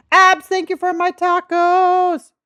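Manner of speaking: high, loud and slow, sing-song thanks; squealing thanks for the tacos; delighted thanks. Real, not acted. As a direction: gush your thanks to a friend. Gleeful thanks to viewer.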